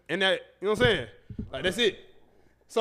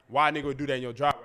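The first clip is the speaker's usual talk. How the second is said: ordinary talk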